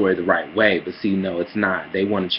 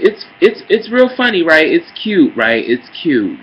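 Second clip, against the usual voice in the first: sarcastic mockery; 9 semitones higher, 8 dB louder than usual. Real, not acted. Sarcastic mockery of police plaque.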